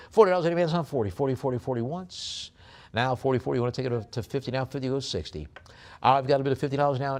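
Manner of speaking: fast, high, loud auctioneer chant; rapid-fire auction call; auction bid calling. Acted. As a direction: rattle off the bids like a live auctioneer.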